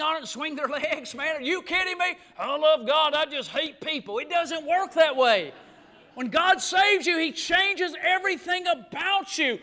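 fired-up preaching. Real, not acted. Preacher prodding a lifeless congregation, fired-up and insistent.

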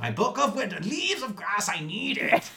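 high, strained whiny demand; mock frantic Walt. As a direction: whine it like a desperate man. Mocking Walt's demand.

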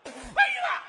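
very high mock finish; mimicked helper's cry. Shown as a demonstration. Vendor mimics helper's final words, very high cry. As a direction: squeal out the last words. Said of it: "那会儿你才来".

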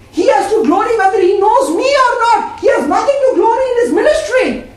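fervent preaching. Real, not acted. Preaching climax, high and loud.

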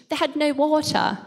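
crisp British accent. Shown as a demonstration. To the audience, joking, in a very British accent. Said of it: "I said that very British".

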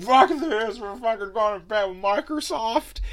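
very high, loud mock outburst; hammed-up yell of an upset PlayStation employee; mock furious staffer. PlayStation staff raging about the Microsoft deal, loud high mock shout.

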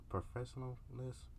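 trailing off in doubt; barely said, unsure it's a word; a soft questioning guess. Real, not acted. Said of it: "However the fuck you say it".

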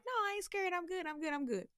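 high-pitched mock insistence; insisting friend. Retelling friend's denial of being scared, high-pitched insistence.

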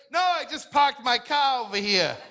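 high-pitched mock Boston accent. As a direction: deny it in a put-on Boston accent. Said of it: "You're from Boston".

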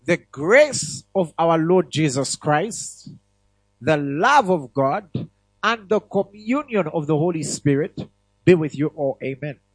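slow, loud solemn blessing; benediction. Acted. Preacher reciting the benediction over the congregation, slow and loud. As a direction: pronounce the blessing over them, slowly.

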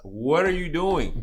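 loud, high, drawn-out mock disbelief; exaggerated retold astonishment; incredulous imitation. Retells driver questioning him, emphatic repeat.

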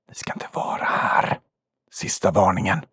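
hushed ghostly warning; menacing whisper. Acted. Game master as hidden voice behind Poppe, warning whisper. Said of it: "samma röst som ni hört viska".

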